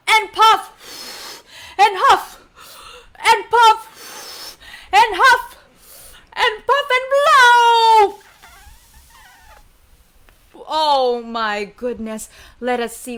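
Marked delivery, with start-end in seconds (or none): 0.1-8.2 loud, high words between big breaths; huffing and puffing wolf. Shown as a demonstration. Storyteller has kids be the wolf, loud slow huffing. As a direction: draw it out with gusts of breath. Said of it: "pretend to be the wolf and huff".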